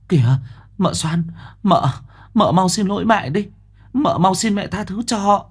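high, anxious urging; urgent pleading. Acted. Elder wife urges Soan to apologize, high and anxious. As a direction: hurry her to beg forgiveness.

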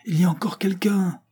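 cri aigu et affolé de la foule, plus haut; lancez-le comme une foule terrifiée; cri d'effroi. A narrator's reading. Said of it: "Un cri d'effroi". (un cri d'effroi, voix montée très haut)

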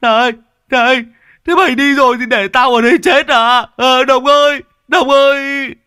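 very high, loud terrified cry; a man left behind calling out in fear; panicked shout. Acted. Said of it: "Lâm Sẹo liền nhảy sổ ra". (Lâm Sẹo hoảng sợ gào gọi, giọng rất cao và to)

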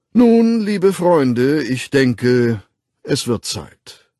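slow, high, loud ceremonial address; slow carrying voice. A narrator's reading. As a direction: draw out each word to a crowd. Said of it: "wunderbar tragenden Stimme".

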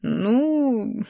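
guilty stalling, quietly drawn out. A narrator's reading. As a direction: drag it out sheepishly. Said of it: "виновато протянул Шейрон".

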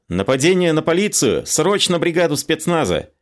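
urgent, high-pitched call for help; hurried cry. A narrator's reading. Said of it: "Мариэтта крикнула торопливо". (торопливый крик высоким голосом, вполсилы)

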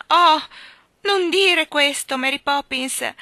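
plead, dragging the words in distress; girl whimpering her nanny's name in pain; whimpering plea. A narrator's reading. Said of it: "ella piagnucolò".

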